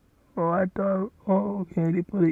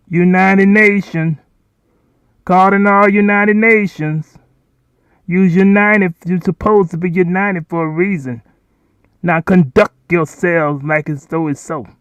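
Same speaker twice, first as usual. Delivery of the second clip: loud proclamation; 3 semitones higher, 10 dB louder than usual. Acted. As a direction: address the United Nations like a preacher from a pulpit. Calling on the United Nations.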